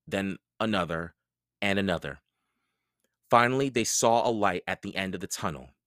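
plain reading voice

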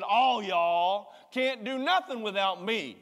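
slow loud Southern "All y'all", with swag; Jesus with Southern swag, slow and loud; Southern swagger. Retold or imitated. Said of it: "shaking his head back with some swag".

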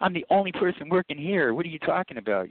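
mild mock annoyance at a coworker; mock angry retort. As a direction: snap back as if insulted, half-played. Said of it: "You know, I got mad".

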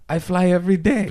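very high-pitched German accent impression. Touring DJ topping their complaint, imitated German accent. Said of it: "he has a slight German accent".

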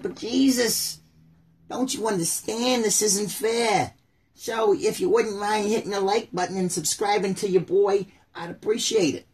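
high, slow whining plea; high whiny voice, turning it into a subscribe plea; Jaya voice. Acted. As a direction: wail it up high as a joke. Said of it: "I gotta do the Jaya voice".

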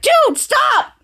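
high-pitched mock outburst; exasperated protest. Volt cuts off Brainstorm's fun facts.

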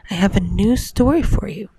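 ordinary delivery

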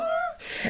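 squeaky high laugh; high giggle. Real, not acted. Laughing at the moment he realized. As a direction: giggle at his own panic. After "oh no.

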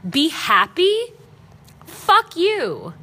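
indignant echo building to a high, loud retort; triggered. Retold or imitated. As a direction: throw the words back in disbelief, then snap. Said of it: "it triggered me today".

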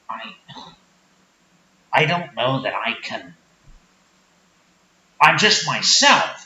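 low-pitched sheepish small-town guy; Rick character. Acted. Rick defends himself.

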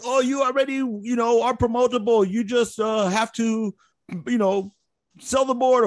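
put-on high Vietnamese-accented pep talk. Sergeant major addressing the soldiers in line, Vietnamese accent.

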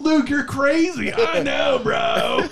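high, drawn-out caricature shout; someone else's voice. Acted. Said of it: "You just do someone else's voice".

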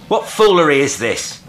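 indignant call. A narrator's reading. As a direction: call it out over the rail. Calling after the vanished boat.

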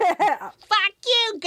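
high squawking Boston seal voice; Hoover. Acted. Storyteller joking as the talking seal, squawked Boston accent. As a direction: squeal it out as a rude Boston seal. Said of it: "that's what Hoover the Seal said".

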